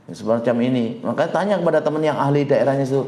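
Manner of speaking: normal talking voice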